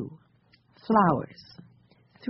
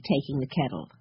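ordinary delivery, nothing marked

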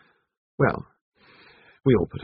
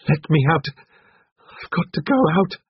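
high, loud, panicked cry to leave; choking out a desperate plea; strangled voice; 10 semitones higher, 4 dB louder than usual. A narrator's reading. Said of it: "said in a strangled voice".

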